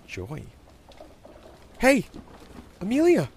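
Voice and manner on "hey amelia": put-on high, loud boy's hail; excited kid calling her name. A narrator's reading. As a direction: call to a friend across the yard. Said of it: "Bobby called".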